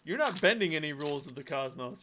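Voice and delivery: normal talking voice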